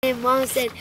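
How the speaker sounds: usual speaking voice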